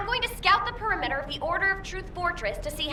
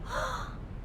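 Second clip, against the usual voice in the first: surprised gasp; 6 semitones higher, 10 dB quieter than usual. Acted. Sudden realisation about Enzo.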